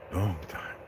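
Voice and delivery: a hint of weary Caesar, quiet; Caesar's reply. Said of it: "Caesar asks".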